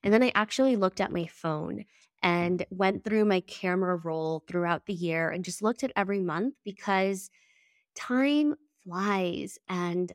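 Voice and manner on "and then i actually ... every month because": ordinary talk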